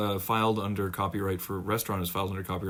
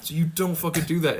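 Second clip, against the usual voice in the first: ordinary talk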